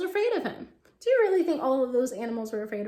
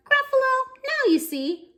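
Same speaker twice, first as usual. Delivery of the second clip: proud little mouse; 12 semitones higher, 5 dB louder than usual. Acted. Mouse triumphing over the Gruffalo, high. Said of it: "The mouse said".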